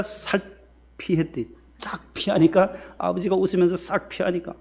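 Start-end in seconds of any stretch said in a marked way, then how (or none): none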